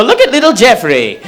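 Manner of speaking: a hint of a gleeful grandpa; doting grandfather.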